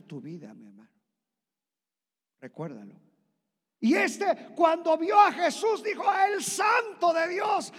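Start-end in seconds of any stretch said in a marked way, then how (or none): 3.8-6.0 high, loud, shouting sermon; preaching at full force. Acted. Preacher shouting the name of Jesus to the church. 6.3-7.7 high, loud, slow shout of dread; terrified demon cry. Acted. Possessed man recognising Jesus, shouting in fear. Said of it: "Se espantó".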